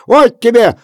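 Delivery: very high, loud threatening yell; furious threat. A narrator's reading. Kuzma strikes Efrem, furious high yell. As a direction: threaten him as you strike.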